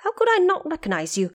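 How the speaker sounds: loud, fast, high exclamation; loud answer. A narrator's reading. Monkey King loudly answers the Bodhisattva, loud and fast. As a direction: call it out with a jolt of recognition. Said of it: "answered loudly".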